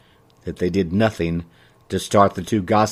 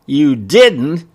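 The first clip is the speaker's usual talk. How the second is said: mock surprised, raised and louder; feigned surprise; 5 semitones higher, 6 dB louder than usual. A narrator's reading. Shopkeeper answers customer's jab, putting on mild surprise. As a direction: act a little too surprised. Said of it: "trying to look surprised".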